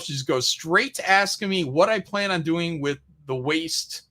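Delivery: normal talking voice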